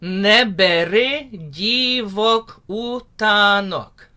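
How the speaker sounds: slow spoken "Neberi divok utanok"; spoken lyric line. Shown as a demonstration. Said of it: "The next line".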